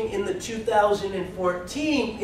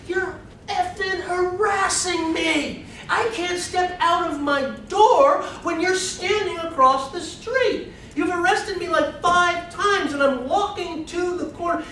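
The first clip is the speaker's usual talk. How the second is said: high, strained protest, much higher pitch; outraged woman in court; 11 semitones higher than usual. Retold or imitated. Fatima protests her arrests to the court, high and indignant. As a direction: voice Fatima snapping back at the court. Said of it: "she finally stands up in court and go like".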